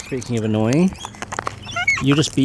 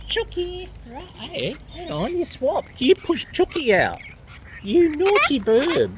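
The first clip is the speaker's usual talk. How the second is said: playful scolding; 12 semitones higher, slower than usual. Real, not acted. Scolding a chicken.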